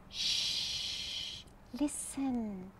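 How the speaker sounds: whispered shh, then soft listen; soft hushing storyteller; hushed shh. Acted. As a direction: hush the children gently.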